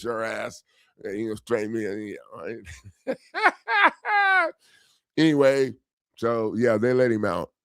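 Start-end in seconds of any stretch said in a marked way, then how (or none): 3.2-4.5 a sudden high burst of laughter; real high laugh; high laughing cry. Real, not acted. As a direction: laugh out at your own rant. Laughing at his own rant.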